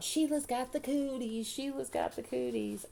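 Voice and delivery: high, slow singsong chant; hollered taunt. A narrator's reading. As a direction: tease in a schoolyard singsong. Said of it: "We hollered really loud".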